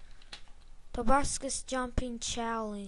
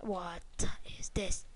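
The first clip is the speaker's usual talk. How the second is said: whisper; 5 semitones lower, 6 dB quieter than usual. Real, not acted. Spooky level, hushed.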